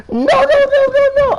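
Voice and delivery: loud, very high screamed string of no's; panicked screaming. Retold or imitated. Telling the cop he wasn't racing, screamed in panic. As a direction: scream your denial, high and sustained. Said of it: "screaming at this motherfucker".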